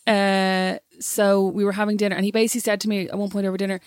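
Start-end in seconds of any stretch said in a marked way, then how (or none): none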